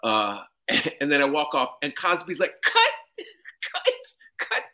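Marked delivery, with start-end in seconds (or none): 2.6-4.6 Cosby impression. Comic mimicking Cosby greeting him on set, high and mocking. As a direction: squeal it out like a teasing old star.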